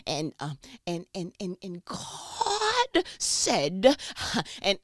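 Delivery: hammed-up pastor stutter swelling into a long holler; preacher tone. Mimicking pastors who all sound the same, preacher tone. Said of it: "where are y'all getting that tone from".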